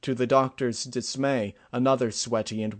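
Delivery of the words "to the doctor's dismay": plain reading voice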